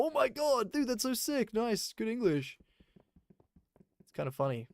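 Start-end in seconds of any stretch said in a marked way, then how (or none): 0.0-2.6 much higher, hammed-up praise, slowing at the end; mock gushing. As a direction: overdo the amazement on purpose. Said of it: "we don't go".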